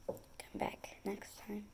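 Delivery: whisper. Real, not acted. Closing whisper to viewers.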